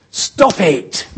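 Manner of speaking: loud, high snapping command; mock shout. Mocking blunt order to the depressed, shouted. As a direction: shout it like a fed-up adviser. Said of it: "just get to the point".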